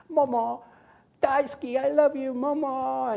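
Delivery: put-on high, sweet little girl voice; toddler voice. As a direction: coo lovingly like a small child. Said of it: "And she goes".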